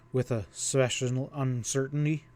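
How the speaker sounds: plain reading voice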